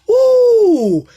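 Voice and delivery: high, loud "woo" of excitement; excited whoop. Real, not acted. Hyped by his own revelation, a real loud whoop. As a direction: let out a joyous holler.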